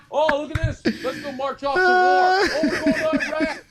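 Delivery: loud, high mock war cry; hammed-up excited yelling; rally the troops in mock excitement, building to a shout; phone's rallying cry. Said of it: "your fucking phone is like".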